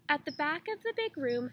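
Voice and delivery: usual speaking voice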